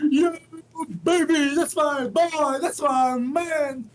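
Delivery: cheering. Real, not acted. Cheering the interviewer's name.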